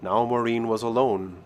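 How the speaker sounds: ordinary delivery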